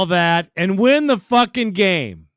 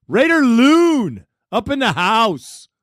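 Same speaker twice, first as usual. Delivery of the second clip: hype announcer call; 4 semitones higher, 3 dB louder than usual. Acted. Radio host hyping up the next caller, big announcer call. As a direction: stretch the name out like a ring announcer.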